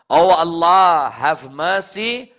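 loud, slow, high pleading cry; impassioned cry to God. Acted. Preacher crying out the Imam's prayer, loud and slow. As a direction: plead with full force, stretching each word.

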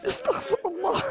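high, sobbing plea; tearful. Real, not acted. Preacher overcome by the tragedy, tearful. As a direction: voice breaking high with real weeping.

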